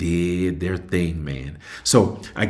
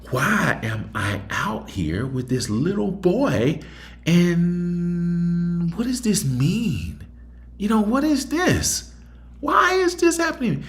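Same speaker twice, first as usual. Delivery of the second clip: each question climbing higher toward a wail; despairing inner questions; 12 semitones higher than usual. Retold or imitated. Single father asking himself why, voice climbing higher. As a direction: let the voice rise further with every why.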